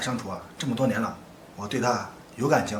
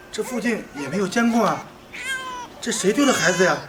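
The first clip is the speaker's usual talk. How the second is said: high, raised voice, at a loss; flustered pleading; 9 semitones higher, 5 dB louder than usual. Acted. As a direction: plead loudly over the crying. Cannot calm the wailing baby.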